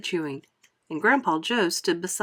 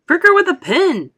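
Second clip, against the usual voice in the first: urgent shout; 11 semitones higher, 10 dB louder than usual. A narrator's reading. Father shouting advice.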